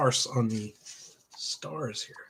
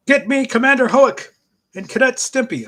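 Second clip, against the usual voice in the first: commander's call; 12 semitones higher, 11 dB louder than usual. Acted. Reading comic character's order.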